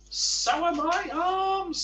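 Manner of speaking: Mister Tickle cheerfully showing off his arms. Acted. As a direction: brighten it right up, slow. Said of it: "said Mister Tickle cheerfully".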